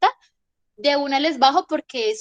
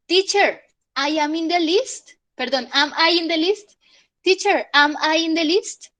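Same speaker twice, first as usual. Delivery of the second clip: high, louder student question; student asking teacher; 5 semitones higher, 4 dB louder than usual. Shown as a demonstration. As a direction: ask the teacher eagerly like a pupil. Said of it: "Por ejemplo".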